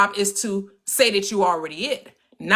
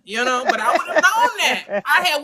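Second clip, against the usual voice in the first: normal talking voice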